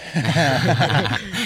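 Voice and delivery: real burst of laughter. Real, not acted. Friend reacting to the bottle-game joke, laughing. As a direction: crack up at the joke.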